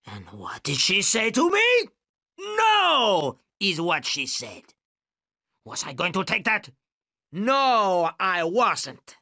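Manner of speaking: sudden high, raging outburst; gangster's scream. A narrator's reading. Gangster recalls Gale refusing him, screaming. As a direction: erupt into a high, mad scream. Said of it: "rose suddenly from a whisper to a scream".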